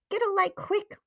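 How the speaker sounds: high, urgent plea; anxious, high-pitched command; frightened urgency. Acted. Frightened aunt urges getting a light.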